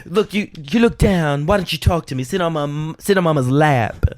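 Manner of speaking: high, coaxing Southern mama; syrupy put-on Southern voice. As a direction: coax your husband with hammed-up diva concern.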